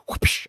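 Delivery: breathy whispered whoosh imitating a swing; whoosh sound effect. Shown as a demonstration. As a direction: blow out a quick whoosh like a bat swing. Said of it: "that whoosh sound".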